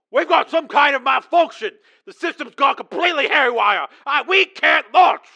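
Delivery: high, loud frantic yelling; panicked shout. A narrator's reading. Launch officer reporting malfunction to commander, panicked shout.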